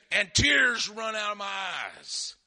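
loud, slow, stretched-out cry; preacher's shouted quote. Retold or imitated. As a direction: draw out "tears" at full volume. Quotes the prophet's story at full force.

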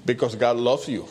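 ordinary talk, nothing marked

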